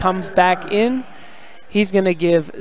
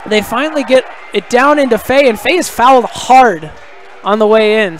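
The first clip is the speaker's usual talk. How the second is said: excited, raised basketball commentary; live play-by-play; 4 semitones higher, 4 dB louder than usual. Real, not acted. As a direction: call the action as it happens, pitch rising with the play.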